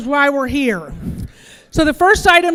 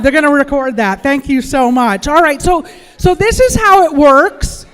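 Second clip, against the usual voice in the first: ordinary talk, nothing marked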